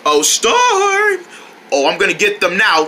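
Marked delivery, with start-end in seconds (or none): 0.1-1.3 high, drawn-out luring call. Pretend. Calling Storm to lure him.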